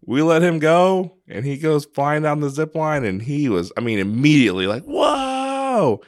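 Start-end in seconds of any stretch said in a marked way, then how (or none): 4.8-6.0 high, drawn-out whoa of wonder; amazed kid. Retold or imitated. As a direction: voice a thrilled kid mid-ride. Son flying down the zip line.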